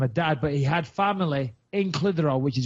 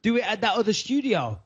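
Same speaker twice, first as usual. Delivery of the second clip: raised, lightly voiced self quote; quoting himself; 5 semitones higher, slower than usual. Host repeats the question he meant to ask, lightly higher. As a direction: replay the question a bit higher. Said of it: "I was gonna say".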